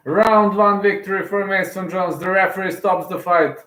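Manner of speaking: victory call calming down; excited announcement fading. Real, not acted. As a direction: keep the thrill, then let it ease off. Announcement winds down.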